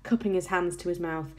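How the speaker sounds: usual speaking voice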